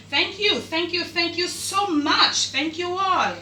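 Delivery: high, drawn-out thanks, slowed on the second thanks; grateful gushing. Real, not acted. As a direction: gush your thanks to the viewers. Thanking subscribers.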